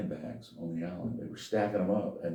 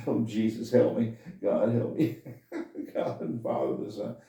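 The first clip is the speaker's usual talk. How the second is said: imitated frantic praying; 4 semitones higher, 3 dB louder than usual. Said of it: "and started praying".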